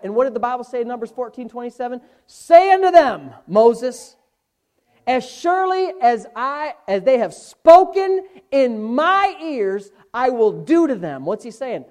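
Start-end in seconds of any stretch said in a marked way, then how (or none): 2.2-11.3 loud, high, slow proclamation; booming pulpit declaration; full-force preaching. Real, not acted. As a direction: thunder the scripture out phrase by phrase. Proclaims God's words at full force.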